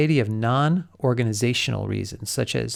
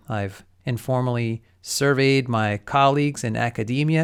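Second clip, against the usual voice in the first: usual speaking voice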